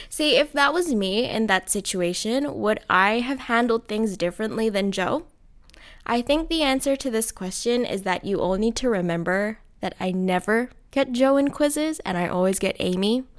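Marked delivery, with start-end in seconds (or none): none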